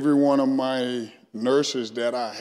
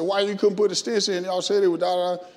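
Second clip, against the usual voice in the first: demanding; 7 semitones higher than usual. Retold or imitated. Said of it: "I said".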